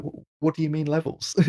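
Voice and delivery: confused little shell voice; Marcel voice. Retold or imitated. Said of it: "He's like".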